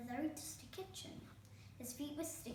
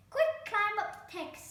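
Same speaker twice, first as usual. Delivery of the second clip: urgent fairy godmother; 11 semitones higher, 9 dB louder than usual. A narrator's reading. Fairy urges Herb.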